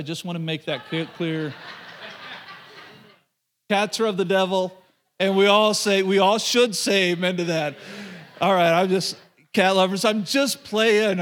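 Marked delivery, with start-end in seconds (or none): 3.7-11.2 joking shout. Real, not acted. Joking about cats to the congregation.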